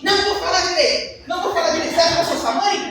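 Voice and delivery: hammed-up wife snapping back; mock angry wife; imitating defiant wife. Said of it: "Ela me falou assim".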